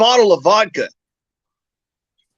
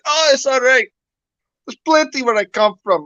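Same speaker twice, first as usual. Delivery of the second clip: Russian character; 6 semitones higher than usual. Acted. Russian character voiced in joke.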